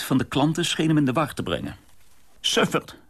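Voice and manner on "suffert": put-on a sharp, high yell at the boy; angry shout. A narrator's reading. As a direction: owner barking at the clumsy dishwasher. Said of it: "schreeuwde de eigenaar". (boze schreeuw)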